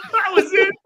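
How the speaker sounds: high laugh running into the words. Real, not acted. Storyteller cracking up at how simply it ended, laughing. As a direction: crack up while saying it. Laughs at his own punchline.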